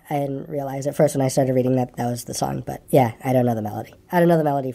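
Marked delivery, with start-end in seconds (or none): none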